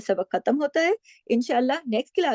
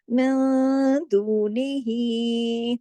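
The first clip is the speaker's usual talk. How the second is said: drawn-out, chanted tajweed reading; slow Quranic recitation; much slower than usual. Shown as a demonstration. Teacher recites the verse slowly for students. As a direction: recite it slowly, holding the nasal sound.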